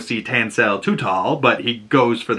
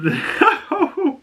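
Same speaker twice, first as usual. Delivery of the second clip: laugh. Real, not acted. Laughing at the clip.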